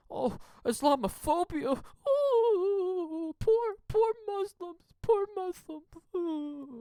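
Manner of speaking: very high, drawn-out fake sobbing; mock wailing pity. Mocking those playing up the tragedy, falsetto wailing. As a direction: wail it in a falsetto parody of grief. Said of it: "they're making this big, this big tragedy up".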